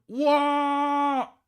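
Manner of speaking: high, drawn-out yell of surprise; loud surprise. Real, not acted. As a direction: cry out, high and long. Exaggerated reaction to chat message.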